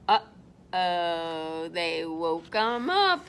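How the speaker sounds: very low, foreboding aside; ominous uh-oh. A narrator's reading. Storyteller warns children the bear is awake, low and ominous. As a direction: drop way down for trouble.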